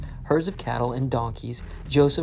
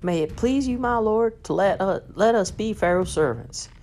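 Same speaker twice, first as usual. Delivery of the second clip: grateful people; 8 semitones higher than usual. Acted. Grateful people beg to serve.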